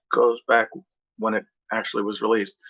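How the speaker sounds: ordinary talk, nothing marked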